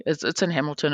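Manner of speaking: ordinary talk, nothing marked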